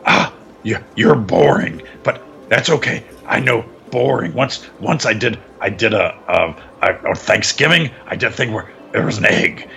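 low, rambling impression, mumbled in fits and starts; Vince. Co-host acts out the boss rambling, low mumbling impression. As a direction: drop the pitch and ramble on haltingly. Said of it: "Vince was saying stuff like".

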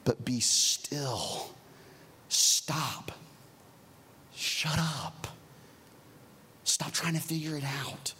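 slow, hushed, firm; gentle command. Shown as a demonstration. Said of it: "pastor leading a pause".

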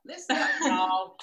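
loud imitation of an American twang; American accent. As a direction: mimic an American hollering to a crowd. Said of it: "a nice American accent would be great".

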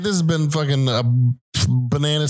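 high, fast mock outro; announcer outro. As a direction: sign off like a host.

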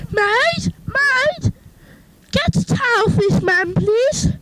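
a grand lady summoning her servant; lady calling the maid. Acted. Lady of the house calls maid.

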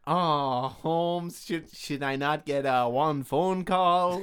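drawn-out Mexican-accented cartoon bird; put-on Mexican accent. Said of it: "I don't know if I should do the accent".

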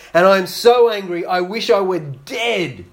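angry Jonah protesting to God. Acted. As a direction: spit it out like a sulking prophet.

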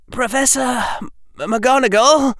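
high, wavering, faltering on the name; halting, high-pitched boy's plea. A narrator's reading. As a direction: stumble over it, voice wavering high. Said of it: "said in a wavering voice".

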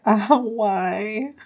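hammed-up poor-me lament; imitating someone sorry for herself; self-pitying whine. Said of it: "she just is really like".

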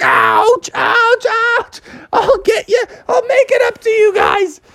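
stung pizza man pleading while rolling on his back; pained yelps. Acted. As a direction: yelp through the stings, pleading. Bubby rolling, stung all over.

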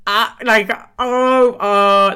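loud mock moaning noises; imitated moaning. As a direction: ham up loud breathy moans. Imitating vibrator going off.

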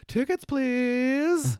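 high, drawn-out sing-song call; high-pitched ticket collector's call; calling conductor. Acted. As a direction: call out for tickets, stretching the last word. Voicing conductor knocking at cabin.

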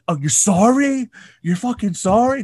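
high, loud sneering repeat; mock sarcastic fury. Imitating Tom Cruise mocking a crew apology. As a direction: throw the apology back in their face. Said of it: "He's like".

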